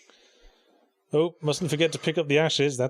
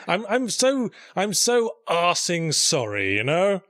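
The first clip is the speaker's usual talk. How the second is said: raised, drawn-out mock apology; sarcastic apology; 4 semitones higher, 3 dB louder, slower than usual. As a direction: hammer out an exasperated fake sorry. Sarcastic apology rant at fans.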